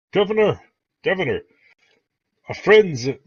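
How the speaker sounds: high, loud hail across a hall; Jared calling out. Acted. Jared hails Nighthill across the mess hall, calling out. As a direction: call out for the governor's attention. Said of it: "you hear Jared go".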